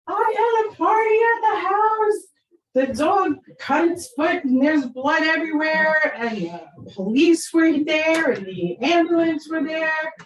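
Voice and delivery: high, loud frantic daughter on the phone; panicked yelling. Retold or imitated. Daughter yelling down the phone in panic. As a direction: shout it out like a panicked teen calling home.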